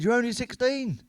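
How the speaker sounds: high, shocked cry; shocked mum. Retold or imitated. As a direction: gasp it out in disbelief. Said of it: "completely shocked".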